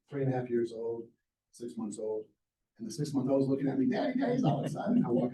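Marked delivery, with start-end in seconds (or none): 3.9-5.0 put-on high, bright toddler voice; little boy calling Daddy; excited little kid. Baby son greets father excitedly.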